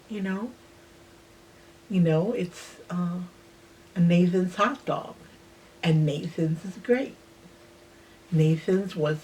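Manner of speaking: ordinary delivery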